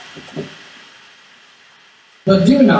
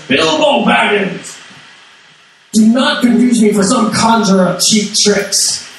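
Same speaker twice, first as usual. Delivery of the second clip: slow, forceful wizard, not screaming; forceful Gandalf; 4 semitones higher, slower than usual. Retold or imitated. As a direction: pronounce it like a wizard putting a hobbit in his place. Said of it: "but very forcefully says".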